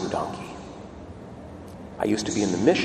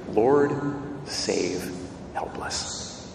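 softer and softer until it ends in a whisper; pleading prayer; 3 semitones lower, 6 dB quieter, much slower than usual. Retold or imitated. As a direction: let the plea fade into a breathless whisper. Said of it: "where you will pray".

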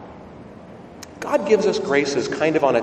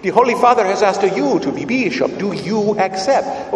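put-on thick Italian accent; 5 semitones higher, 4 dB louder than usual. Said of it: "in a thick Italian accent".

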